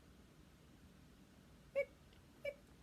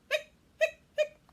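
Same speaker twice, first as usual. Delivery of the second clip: hiccup sounds; 13 dB louder than usual. Acted. Skeleton hiccuping.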